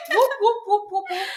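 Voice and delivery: high, loud whoops through laughter; celebratory whooping. Real, not acted. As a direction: whoop to welcome the guest, laughing. Cheering the guest's introduction.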